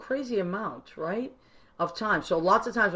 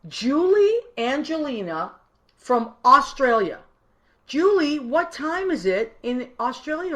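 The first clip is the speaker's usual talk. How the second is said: excited greeting; 7 semitones higher, 5 dB louder than usual. Real, not acted. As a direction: call the name out like waving across a room. Greets a viewer joining live.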